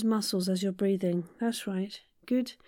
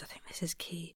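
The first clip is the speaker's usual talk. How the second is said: whispered aside; 4 semitones lower, 16 dB quieter than usual. Real, not acted. Aside in teaching.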